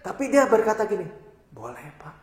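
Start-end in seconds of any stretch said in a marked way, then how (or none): 1.5-2.1 much softer, meek acceptance; the thug softly accepting the offer of prayer, quiet and gentle. Retold or imitated. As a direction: let the tough man answer softly. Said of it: "Dengan lembut".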